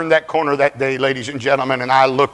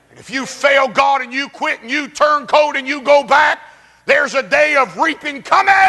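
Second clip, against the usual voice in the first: full-force preaching; 13 semitones higher than usual. Acted. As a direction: thunder it at the congregation.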